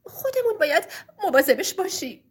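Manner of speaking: crying kind wife pleading. Acted. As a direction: beg through sobs not to send him away. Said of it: "گریه کرد و گفت".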